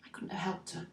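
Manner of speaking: soft, deflated admission; subdued resignation. Acted. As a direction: let it sink quietly into helplessness. Resigned after arguing with guilt.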